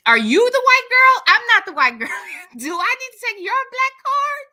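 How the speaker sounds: high-pitched teasing squeal of disbelief; squeaky mock interrogation, starting loud; mock outrage. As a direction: challenge them in playful, very high outrage.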